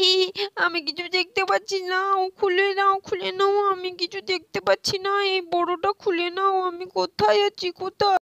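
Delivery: high, whiny cry for help under the cap; a child who can't see, begging to be freed; panicky child. Acted.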